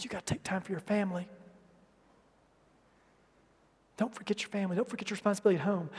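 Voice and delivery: hushed whisper of counsel; whispered advice. Retold or imitated. Older man quietly warning a workaholic young man, whispered. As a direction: lean in and murmur it privately.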